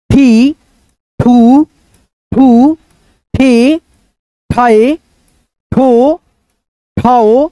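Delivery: drawn-out, sing-song recital of each syllable; syllable drill. Shown as a demonstration. Teacher reciting the ज syllable chart to students, sing-song. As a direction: chant the barakhadi one by one for pupils. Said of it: "सारे अक्षरों पे बैठाते जाएंगे".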